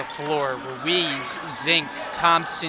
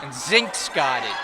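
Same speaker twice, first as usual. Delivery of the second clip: usual speaking voice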